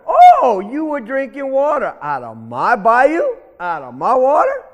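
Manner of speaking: high, loud "oh" into outraged questioning; indignant accusation. Acted. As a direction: challenge the rabbit like an offended bully. Alligator mockingly accuses rabbit.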